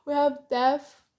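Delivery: ordinary talk, nothing marked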